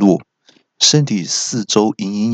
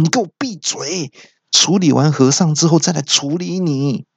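higher, cartoonish thug voice; mildly voiced, shutting him up; a touch of a bullying fighter; snapping thug; 7 semitones higher than usual. Fighter snaps at narrator.

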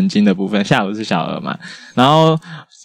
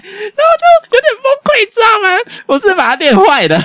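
exasperated; 3 dB louder than usual. Real, not acted. Laughing despair over the child.

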